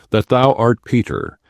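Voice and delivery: usual speaking voice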